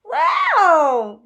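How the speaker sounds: high, loud gasp of wonder; be dazzled; loud, high-pitched wow; amazed wow. A narrator's reading. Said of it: "said Phoebe".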